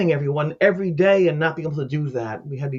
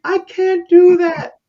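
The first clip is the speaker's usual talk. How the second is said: high, loud, drawn-out wail; retold distraught cry; 13 semitones higher, 9 dB louder, much slower than usual. Mother refusing to go in to her dying girl, distraught cry. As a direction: imitate a mother crying out in refusal.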